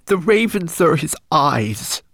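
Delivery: high, slow, tearful confession; sobbed. Acted. Homunculus confessing to Ben, sobbing. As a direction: weep it out slowly. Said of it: "sobbed the homunculus".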